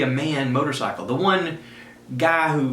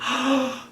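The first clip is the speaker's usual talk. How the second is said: gasp; 12 semitones higher than usual. Retold or imitated. Boy sees birthday motorcycle.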